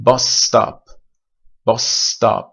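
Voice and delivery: bus stop with one long held s; geminated bus stop. Shown as a demonstration. Teacher modelling the long s in bus stop. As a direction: hold the s across bus stop. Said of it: "چند تا مثال بزنیم".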